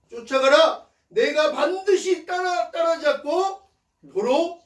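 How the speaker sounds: very high, loud, ringing promise; God's answer. Retold or imitated. God promises David he will catch them, high forceful proclamation. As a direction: proclaim the divine order with force, stretching the key words. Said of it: "라고 응답합니다".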